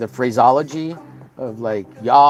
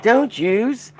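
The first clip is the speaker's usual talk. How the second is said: high-pitched imitated Southern woman; hammed-up southern store lady accent; real southern lady; 10 semitones higher, 4 dB louder than usual. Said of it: "She had a real, real southern".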